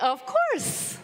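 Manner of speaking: high, forced cheerful reply; fake confidence. Speaker answering the theater veteran, forced fake confidence. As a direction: sound sure while faking it. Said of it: "with a fake smile and a fake confidence".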